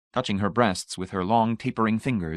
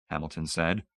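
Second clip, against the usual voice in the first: ordinary delivery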